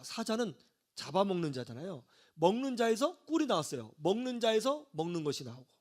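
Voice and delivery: normal talking voice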